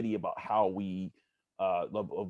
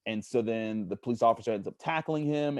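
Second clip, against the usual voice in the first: ordinary delivery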